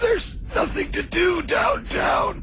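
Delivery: high, slow, drawn-out yell; shouting. Acted. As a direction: bellow it out in a stretched high shout. Star Wars parody, sudden outburst.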